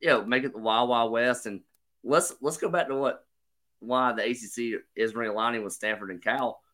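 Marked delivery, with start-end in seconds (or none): none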